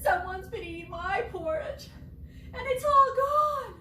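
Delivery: put-on high little Baby Bear rising into a squeaky wail; small sad bear climbing high; lightly voiced sad Baby Bear. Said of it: "He said".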